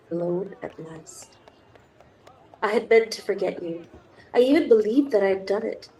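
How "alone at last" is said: soft intimate whisper. Acted. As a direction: breathe it to the lover. Romantic moment, whispered.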